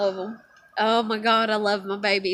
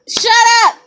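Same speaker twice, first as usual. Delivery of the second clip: sudden loud, high yell; shout at dogs; 11 semitones higher, 9 dB louder than usual. Real, not acted. Yelling at barking dogs.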